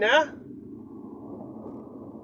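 ordinary talk

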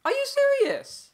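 very high, loud squeal of disbelief; incredulous screech. Real, not acted. Outraged at game event.

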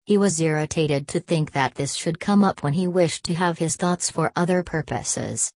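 plain reading voice

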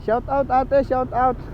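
loud friendly shout; calling out. Real, not acted. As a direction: call out across the road. Calling out to a woman outside.

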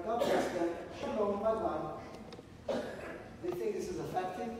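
ordinary talk, nothing marked

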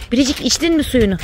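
high, loud coaxing pet voice; sing-song coaxing to the pet; pet talk. Real, not acted.

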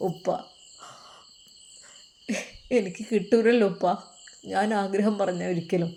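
lightly acted, subdued young man; halting and hopeless, with long pauses; heartbroken son. Son telling father she is lost to him, heartbroken.

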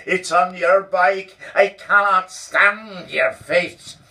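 loud, high furious rant; lost control. Acted. Boss throwing Donald out, furious shouted rant. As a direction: boss losing it, yelling at full volume. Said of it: "he lost his self-control".

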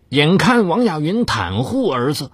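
ordinary delivery

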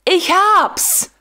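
sudden high cry of discovery. Acted. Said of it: "schrie er plötzlich auf".

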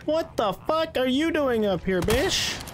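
panicked shout at the enemy upstairs; startled yell. Real, not acted. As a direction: yell it at the ambush.

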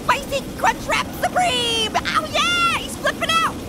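high-pitched gloating cheer; wild glee. Real, not acted. Player watches burning Shrek flip out, wild glee. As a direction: whoop it out far above normal pitch.

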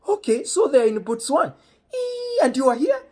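high, loud mock dad voice; imitating friend's dad. Friend's dad quizzing the visiting boy, high, loud impression. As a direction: put on an excitable, high-pitched father.

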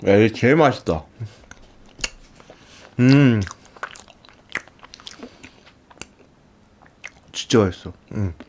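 talking while chewing; muffled by chewing food; mouth full, chewing. Real, not acted. Seen 2: Puts pink food in mouth at -7; chews while talking throughout.

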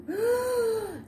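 sharp high gasp of delight; excited gasp. Real, not acted. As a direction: gasp with joy at the start. Excited vlog opening gasp.

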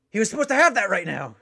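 loud, high panicked realisation; alarmed shout. Acted. Guard captain realising the missed cooking lesson, shouting. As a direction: yell it as the plan dawns.

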